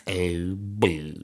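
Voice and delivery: low, stretched silly voice; deep drawn-out voice bit; put-on voice. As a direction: drag it out down low. Resumes the put-on plosive voice.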